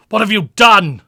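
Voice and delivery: yelled high and loud in anguish; a grief-struck husband roars at his brother; furious shout. Retold or imitated. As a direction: scream it at him over her body. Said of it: "I shouted and attacked".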